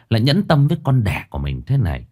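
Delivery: plain reading voice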